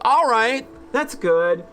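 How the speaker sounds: high, loud burst of relief; relieved cheer. Real, not acted. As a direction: cheer the turn for the better. Relieved at calmer reply.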